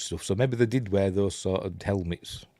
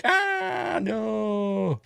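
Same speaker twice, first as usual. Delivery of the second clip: shocked cry; 13 semitones higher than usual. Real, not acted. Reacts to a character being shot.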